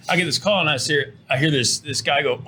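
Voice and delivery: usual speaking voice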